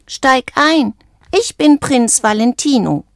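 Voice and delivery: high-pitched princely announcement; proud little frog. A narrator's reading. Frog inviting the bird into his car, high and proud.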